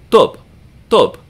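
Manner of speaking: tub as one quick short syllable; short vowel. Shown as a demonstration. Teacher demonstrating a short syllable, brief vowel in tub. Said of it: "So this is a short syllable".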